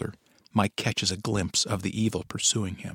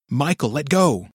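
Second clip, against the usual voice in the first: shout; 6 semitones higher, 5 dB louder than usual. A narrator's reading. Choking wife begs.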